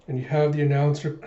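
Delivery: normal talking voice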